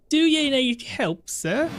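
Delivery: high, slow put-on woman's voice; horrible female voice attempt. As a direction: squeak it out as a bad lady voice. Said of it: "That's a horrible voice".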